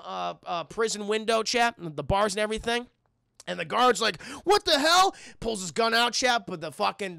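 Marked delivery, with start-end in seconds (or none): 4.4-5.1 higher, louder cry of disbelief; startled guard. Retold or imitated. Prison guard confronts a climber at the window, startled. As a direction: blurt it out, startled and loud.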